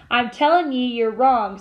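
usual speaking voice

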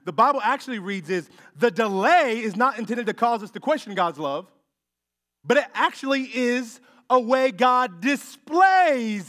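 loud, high preaching cry; full-force preaching. Acted. Preacher urging the congregation on the delay, loud preaching. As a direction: preach it out to the whole room.

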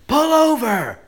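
high comic shout; hammed-up cop shouting; mock yell. Cop yells at speeding woman.

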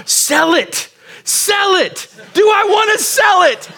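high, shouted disbelief; rising incredulous yell. Retold or imitated. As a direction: yell it back in confusion. Confused shouting at landscaper.

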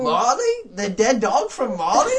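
high, loud incredulous squeal; hammed-up disbelief with a laugh in it; mock shock. Scrooge questioning the ghost, high mock shock.

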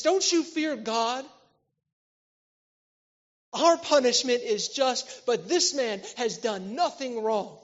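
the second criminal rebuking the first, loud and slow; stern rebuke. Acted.

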